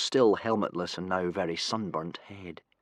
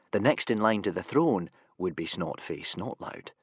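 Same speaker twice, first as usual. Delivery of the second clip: plain reading voice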